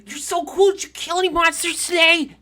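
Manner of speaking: put-on very high, loud, gushing child voice; excited kids. Daycare kids greet their monster-killing hero, excited high voice. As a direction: gush like starstruck little kids. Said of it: "and they're like".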